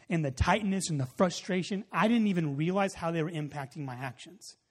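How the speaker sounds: ordinary talk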